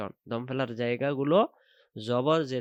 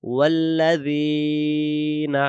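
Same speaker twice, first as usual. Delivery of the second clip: drawn-out chanted recitation of ওয়াল্লাযীনা, louder; slow Quran recitation; 5 dB louder, much slower than usual. Shown as a demonstration. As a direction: recite ওয়াল্লাযীনা slowly with the doubled lam held. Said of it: "তাকে নিয়ে উচ্চারণ করে আমরা উচ্চারণ করব".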